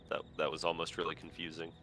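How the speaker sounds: normal talking voice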